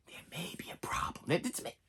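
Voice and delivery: very soft, voiceless whisper; creeping doubt whisper. Acted. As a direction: whisper it like a nagging thought. Creeping worried thought mid-movie.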